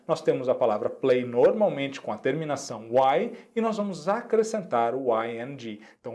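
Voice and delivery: ordinary delivery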